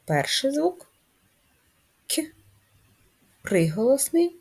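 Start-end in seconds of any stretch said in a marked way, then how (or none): 2.1-2.2 К as a short softened sound; softened consonant. Shown as a demonstration. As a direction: sound out К softly palatalised, by itself. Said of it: "Пом'якшений він".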